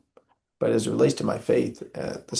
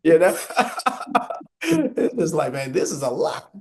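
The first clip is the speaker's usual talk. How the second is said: higher, laughing through the words; 7 semitones higher, 5 dB louder than usual. Real, not acted. Uncle laughing about caring for his late brother's sons, laughing. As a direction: laugh it off, overwhelmed but amused.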